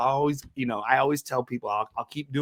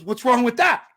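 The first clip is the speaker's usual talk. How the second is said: defensive dad; 10 semitones higher, 6 dB louder than usual. Retold or imitated. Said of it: "he goes".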